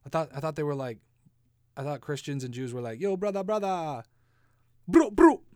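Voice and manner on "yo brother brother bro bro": high, loud bro greeting; bro talk. Retold or imitated. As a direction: shout it like a hyped-up bro. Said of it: "that's how they talk".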